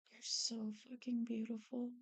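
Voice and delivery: hushed, breathy adoration; adoring whisper. Real, not acted. Artist to the character being drawn, adoring whisper. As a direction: whisper it tenderly to yourself.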